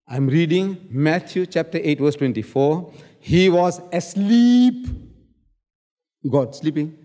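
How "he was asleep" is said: slow, loud "asleep" drawn out high; slow emphatic quote. Real, not acted. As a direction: lean hard on "asleep" to make the point. Quoting verse, mocking point.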